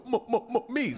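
high repeated "m-" before "me"; stutter. Retold or imitated. Comic mimics a heckler answering from the crowd, stuttering high. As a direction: imitate a stammering voice from the back. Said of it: "I hear this voice go".

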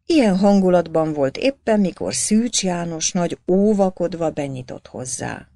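ordinary delivery, nothing marked

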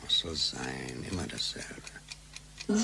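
slow, soft and tired, barely voiced; a slow, resigned half-whisper; weary whisper. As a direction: sigh it out as if nothing ever changes. Weary husband answers wife.

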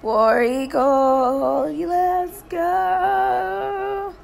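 loud stretched team cheer. Real, not acted. Cheering for Auburn football.

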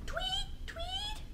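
very high, light tweets; high, short birdy peeps; bird tweet. Shown as a demonstration. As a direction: chirp it like a little bird. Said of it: "Can you tweet like a bird?".

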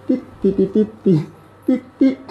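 high little "tit-tit" sound effects; playful ticking noises. Pretend. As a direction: chirp out quick high ticks. Imitated noises while adjusting.

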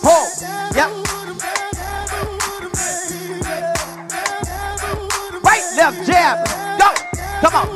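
high, loud, pumped-up coaching shouts; hyped fitness coach. Acted. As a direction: call out the moves over the music like a workout instructor. Leads a live workout class over music.

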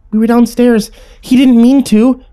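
high, child-voiced plea, stretched at the end; pleading. A narrator's reading. Little sister excuses her friend for killing Peter, pleading. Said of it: "Tammy pleaded".

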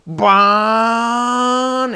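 high, sustained engine whine; long rising boat noise; boat taking off. Retold or imitated. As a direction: imitate a motor revving away. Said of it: "I hear the boat go".

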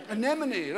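"Anemone" said crisply and correctly, with a laugh; anemone said carefully. Shown as a demonstration. Correcting a mispronouncer, "Anemone" said crisply and correctly. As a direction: say "Anemone" as a-NEM-o-nee, with a laugh. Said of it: "saying anemone".

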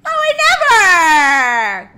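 high, loud, slow scandalized shout; mock scandalized. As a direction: clutch your pearls.

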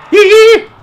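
high, loud "yee" squeal; startled yelp. Real, not acted. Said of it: "that's the noise I'd imagine I make".

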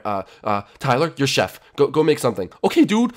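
usual speaking voice

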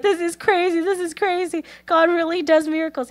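high, overwhelmed mock-crying voice; imitating happy bawling. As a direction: marvel through imitated tears. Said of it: "And he's just bawling".

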